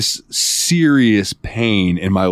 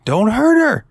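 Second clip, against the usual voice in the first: put-on much higher, louder cry of a little girl; a kid shouting at the monster; little girl's plea; 3 dB louder than usual. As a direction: plead like a scared child. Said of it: "the little girl's like".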